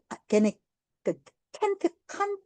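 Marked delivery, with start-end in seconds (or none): 1.5-2.4 can't said with a broad British "ah"; can't as "cahnt", repeated. Shown as a demonstration. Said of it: "can't 발음하자".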